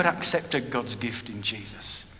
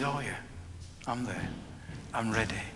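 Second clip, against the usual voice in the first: soft; 4 semitones lower, 13 dB quieter than usual. Retold or imitated. Dying brother's answer retold.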